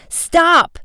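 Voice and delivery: high-pitched urgent shout; sharp rise in pitch, calling out. A narrator's reading. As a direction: shout it to halt someone fleeing. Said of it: "shouted Frank".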